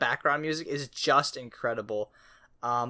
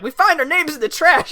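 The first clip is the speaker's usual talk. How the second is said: much higher, louder, gleeful boast; proud raccoon shout; 12 dB louder than usual. Retold or imitated. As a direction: yell it like a proud discovery. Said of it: "they're like".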